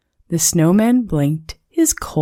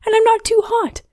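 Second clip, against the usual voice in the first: happy snowman; faster than usual. Acted.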